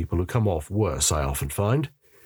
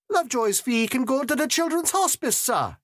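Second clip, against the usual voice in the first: much higher woman's voice, firm; Alicia's voice. A narrator's reading. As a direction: cut in brightly and take charge. Alicia speaks to the mayor.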